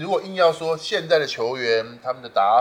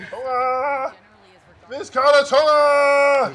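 battle roar; 10 semitones higher, 7 dB louder, much slower than usual. Real, not acted. Cheering own player in game.

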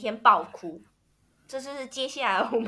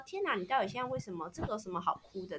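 quoting the husband; 3 semitones lower, 13 dB quieter, faster than usual. Retold or imitated. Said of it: "哭的叔叔就会觉得说".

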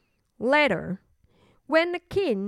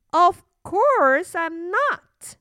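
high, loud, slow denial; indignant little princess; 6 semitones higher, 5 dB louder, much slower than usual. Acted. Princess denying fear of monsters, indignant. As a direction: deny it as an offended little girl. Princess denies being frightened.

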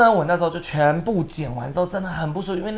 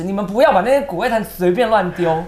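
ordinary talk, nothing marked

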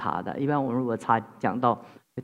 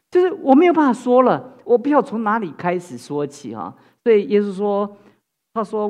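louder, higher preaching, building with fervor; impassioned preaching; 6 semitones higher, 6 dB louder than usual. Real, not acted. Pastor pressing the congregation, impassioned raised preaching. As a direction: drive the point home from the pulpit.

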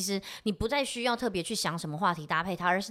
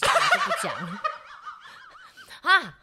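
squeals into high laughter; laughing; 4 dB louder, slower than usual. Real, not acted. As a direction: break up laughing. Child line dissolves in laughter.

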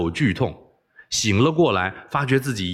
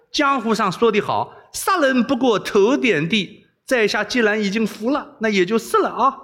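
high, hammed-up outlaw swagger with a laugh; mock bandit bravado; 10 semitones higher than usual. Captive boy plays along as a bandit to the princess, hammed-up swagger. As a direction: play the defeated jianghu hero for her game.

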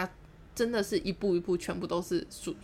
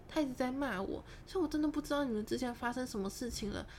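soft, higher, placating voice; feigned innocent concern; 4 semitones higher, 7 dB quieter than usual. Retold or imitated. Speaker to the man about a supposed quarrel, feigned concern. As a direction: play it innocent and gently worried, pitched up. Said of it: "我就这样子讲".

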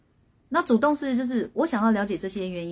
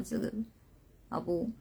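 ordinary talk

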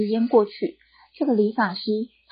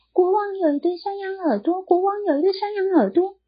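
high, sing-song flute voice; reed flute voice; 11 semitones higher than usual. Acted. The reed flute piping the secret, high sing-song.

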